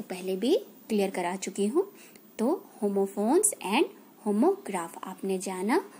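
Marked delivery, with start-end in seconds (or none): 2.4-5.9 stretched, deliberate words; drawn out so the kids can follow; slow recap. Shown as a demonstration. Teacher summing up for kids, slowly. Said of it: "slow recap for kids".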